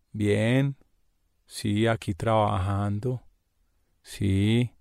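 low, soft, listless answers; dispirited, flat. Retold or imitated. Coworker answering the phone, low and listless. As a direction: sound like you can't be bothered to talk.

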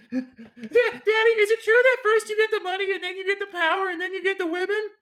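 put-on high, squeaky child voice; little kid voice. Timmy asking daddy about Scarface, squeaky child voice. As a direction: play a naive little boy.

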